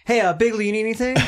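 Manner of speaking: high, chummy call across a bar; mock friendly greeting. Cohost imagines greeting Big Lee at a bar, chummy. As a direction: greet him overly friendly.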